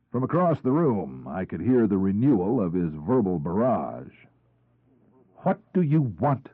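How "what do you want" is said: sharp, curt question, higher; blunt. A narrator's reading. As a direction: cut him off bluntly. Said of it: "she interrupted him bluntly".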